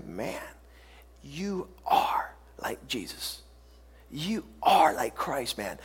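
soft, low, slow imitated awe; hushed amazement. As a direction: draw it out under your breath. People marvel at the believer.

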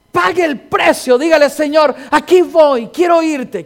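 loud, proclaiming preacher's cadence, hammering each repeated verb; preacher proclaiming to the congregation how his mother prayed, loud and fervent; preaching at full force. Acted. Preacher continues exhortation.

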